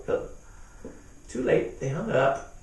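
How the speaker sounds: ordinary delivery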